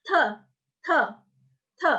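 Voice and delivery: te with a puff of air, no spitting; short bursts of air on te; aspirated te. Shown as a demonstration. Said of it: "jangan sampai muncrat".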